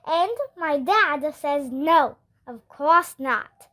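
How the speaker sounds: ordinary delivery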